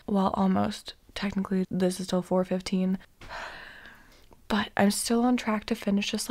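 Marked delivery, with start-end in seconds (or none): 4.5-6.3 hushed late-night whisper. Real, not acted. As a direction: whisper so nobody wakes. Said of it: "we have been whispering because Matt is asleep".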